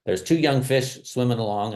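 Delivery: ordinary talk, nothing marked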